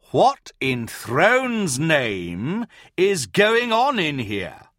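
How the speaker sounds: slow, high, loud demand. Acted. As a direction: demand an answer sternly. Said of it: "Hart demanded".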